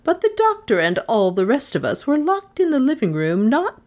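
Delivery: proud of her obstinacy; proud old woman. A narrator's reading. Miss Cornelia answers the others, proud and stubborn.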